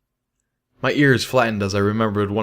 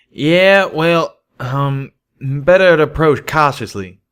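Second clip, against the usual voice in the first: loud, higher, embarrassed backpedal; flustered; 6 semitones higher, 7 dB louder, slower than usual. A narrator's reading. Calamity flustered after being caught out, louder and higher. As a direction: bluster to cover the blush.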